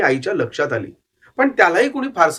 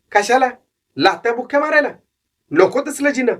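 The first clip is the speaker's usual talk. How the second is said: put-on young runaway boy, higher voice, earnest; boy's voice; 7 semitones higher than usual. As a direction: plead your case to the old man. Boy refuses going home.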